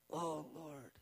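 soft, imitated tearful prayer; hushed, mildly weepy plea; gently weeping. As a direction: pray quietly as if gently weeping. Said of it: "little gently weeping".

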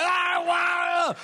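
high, loud overwhelmed outcry; loud astonished cry. Retold or imitated. Man freed from fear cries out, loud and high. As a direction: let it burst out of you. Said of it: "And he's like".

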